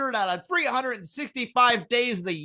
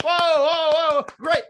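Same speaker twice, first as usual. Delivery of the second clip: higher, louder mock wow at a magic trick; wowed by a magician's trick, loud amazed gasps; mock amazement; 8 semitones higher, 5 dB louder than usual. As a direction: gasp it out like a wowed audience member. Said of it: "you're like".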